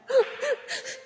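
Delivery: a high laugh close to tears; high teary laugh. Real, not acted. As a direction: laugh out in relief, almost crying. Laugh in testimony.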